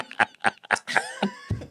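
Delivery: a high, squealing burst of laughter; shrieking laugh. Real, not acted. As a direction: let out a squeal of delighted laughter. Recalling the cheeky joke.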